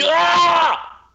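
sudden high yelp of shock; startled cry. Retold or imitated. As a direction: jolt out a high startled shout. Imitating startled reaction.

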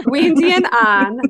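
"Weendy and An", loud, with long ee and ah; playful high "Weendy and An", vowels stretched; Weendy and Ahn. Pretend. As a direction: say An as "ahn", Weendy as WEEN-dee. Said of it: "I minus pronounced An".

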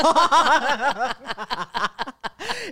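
high loud laughter burst. Real, not acted. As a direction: laugh hard at the memory. Laughing at cousin's line.

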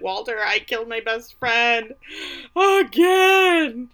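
mock tearful wail, building to a shout; hammed-up crying confession; Misty. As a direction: wail it, the last word high and loud. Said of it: "Misty just dissolves into tears".

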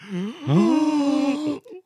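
high mock gasp; hammed-up gasp of shock.

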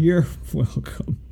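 slow "You're welcome" broken by a laugh. Real, not acted. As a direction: giggle through the words. Echoes the joke while laughing.

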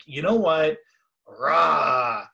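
loud mock rage, a roar at the end; furious outburst. Caller losing it with customer service, loud furious roar. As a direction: blow up at the agent. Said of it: "You are just furious".

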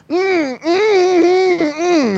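high muffled Kenny-style whimpering mumble; exaggerated muffled mumbling. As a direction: whine through a closed mouth. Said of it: "an over-exaggerated Eric Car-, or, uh, uh, Kenny McCormick".

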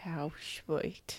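soft whispered aw. Real, not acted. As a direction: whisper it sweetly. Whispered reply.

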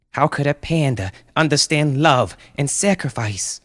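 high, impassioned disbelieving mother; crying out. Acted. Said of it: "cried Brenda".

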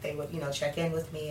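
ordinary talk

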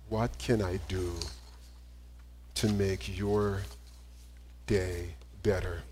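quiet tender closing question; tender, slow question. Real, not acted. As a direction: ask it gently, like a prayer. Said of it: "I would offer to you".

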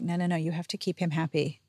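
ordinary talk

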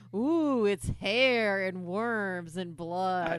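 drawn-out Halloween ghoul "ooh"; slow, wailing haunted-house spook; mock-spooky. Mocking cheesy Halloween party props, hammed-up spooky.

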